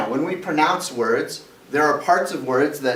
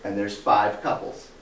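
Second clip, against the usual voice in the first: ordinary delivery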